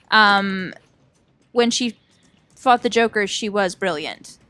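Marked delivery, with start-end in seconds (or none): none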